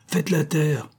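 sharp outcry, pitched high; exclaiming. A narrator's reading. Cochon cutting Jeanne off, high sharp outcry. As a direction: cry out, up high and sudden. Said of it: "s'écria Cochon".

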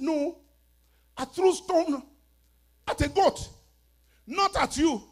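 high, loud, emphatic denial; acted protest. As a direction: protest your innocence in punched short bursts. Acted protest to accusing dream visitor.

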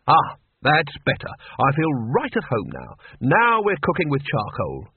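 ordinary delivery

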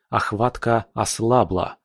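plain reading voice

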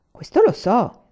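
quick, lively retort. A narrator's reading. Sly old carpenter to the student, lively. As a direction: snap back with sly glee. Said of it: "rispose vivacemente Maestro Pane".

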